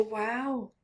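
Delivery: usual speaking voice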